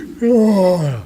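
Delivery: big sleepy yawn; loud, falling yawn; acted yawn. A narrator's reading. Flint after too big a meal, sleepy yawn. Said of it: "they get sleepy".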